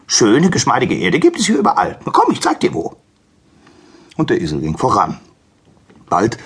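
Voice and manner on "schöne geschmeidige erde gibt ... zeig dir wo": high, loud donkey voice; keen donkey, pitched high; helpful donkey. Acted. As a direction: eagerly offer to lead the way. Donkey offers to show the way.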